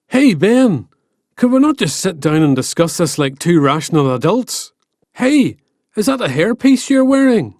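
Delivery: high, loud mock-reasonable goading; cheeky Finn. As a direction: needle him with fake calm.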